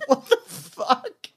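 high-pitched, drawn-out mock shock; retold squeal of disbelief; stunned disbelief. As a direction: react in laughing, baffled disbelief. Teller's stunned reaction to the kid.

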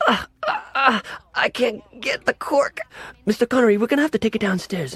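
put-on high, fussy English waiter accent; a hammed-up English waiter who can't get the cork; bad English accent. Flustered English waiter to Mister Connery, bad English accent. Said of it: "I've got a really bad English accent".

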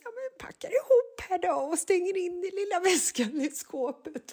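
high sing-song mutter to herself; mock chirpy self-talk. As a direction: cover the awkwardness chirpily. Mother talking to herself alone. (låtsat glad, hög småpratande röst)

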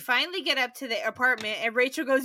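ordinary talk

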